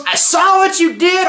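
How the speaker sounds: high, excited shout; excited callout. Real, not acted. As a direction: call out the ad, delighted.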